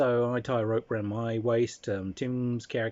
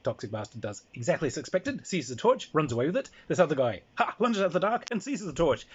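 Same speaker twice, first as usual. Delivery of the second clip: ordinary talk, nothing marked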